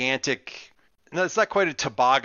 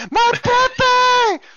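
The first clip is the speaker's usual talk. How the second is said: very high, loud hammed-up wail; panicking Dominican grandmother; 10 dB louder than usual. Grandmother fighting her rescuer to reach Pepe, panicked shriek. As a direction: shriek frantically in a high granny voice. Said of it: "she's freaking out".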